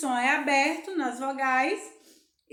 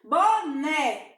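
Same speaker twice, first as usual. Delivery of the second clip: "Boné" said louder, punching the last syllable; stressed final syllable; 5 dB louder than usual. Shown as a demonstration. Teacher showing pupils the tonic syllable, stressed last syllable. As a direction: hit the "né" of "Boné" hard. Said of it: "acento agudo na sílaba tônica".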